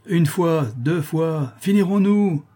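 high, exasperated count; fed-up bailiff, pitch climbing; impatient warning. A narrator's reading. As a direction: count off, then demand an end. Bailiff impatiently gives final warning.

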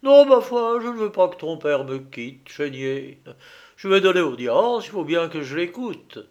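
high, loud, icily composed refusal; calm cruelty with a sharp edge; cold sharp voice. A narrator's reading. Robespierre coolly refusing to let the father leave, sharp and icy. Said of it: "avec sa voix aiguë et son incroyable sang-froid".

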